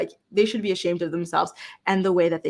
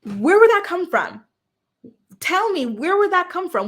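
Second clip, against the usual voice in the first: incredulous; 9 semitones higher, 5 dB louder than usual. Real, not acted. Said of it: "rhetorical challenge".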